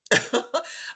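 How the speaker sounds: quick breathy laugh; a light unvoiced chuckle rising up; short laugh. Real, not acted. Laughs at her own story.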